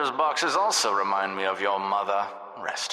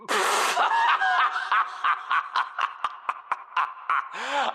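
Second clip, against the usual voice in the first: maniacal laugh. Acted. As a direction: cackle with glee at the kill. Taunting after destroying player.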